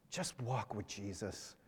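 slow, faint dying whisper; whispery dying voice. Retold or imitated. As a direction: gasp it out like final words. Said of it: "he'd come back and say a similar thing".

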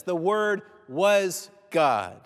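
"the Word was God" drawn out slowly, weight on "was"; slow emphatic stress. Shown as a demonstration. Preacher revealing John's third statement, slow and weighty. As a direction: land each word slowly, leaning on "was". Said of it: "Now our heads start to spin".

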